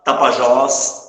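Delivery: TAPAJÓS loud and slow, held on the last syllable; final stress. Shown as a demonstration. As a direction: call out tapajós with a long final ó. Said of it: "Por isso que ele é acentuado". (tapajós alto e devagar, com o ó final esticado)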